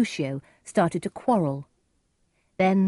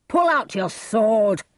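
slow, higher shouted challenge; shouting; 4 semitones higher, 4 dB louder, slower than usual. A narrator's reading. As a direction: shout the demand, drawing out each word. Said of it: "he shouted again to Romeo".

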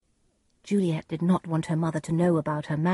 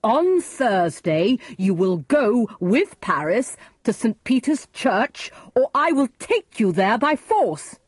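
stern, emphatic command, building higher; angry threat, rising in pitch; very angry father; 6 semitones higher, 5 dB louder than usual. A narrator's reading. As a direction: lay down the law, hitting each key word. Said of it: "Lord Capulet was very angry".

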